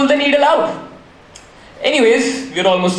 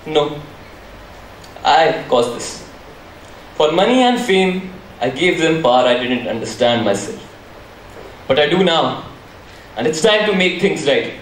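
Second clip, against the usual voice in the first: low remorseful; 7 semitones lower than usual. Acted. Hacker owns his guilt.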